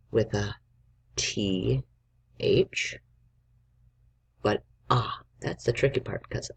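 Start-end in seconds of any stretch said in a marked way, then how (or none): none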